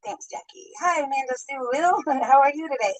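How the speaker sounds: ordinary talk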